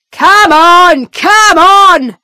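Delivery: loud, high, angry hurry-up shout; angrily. Acted. Guard rushes the stunned prisoner, angry loud shout. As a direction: snap at her to move, losing patience. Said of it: "the guard says angrily".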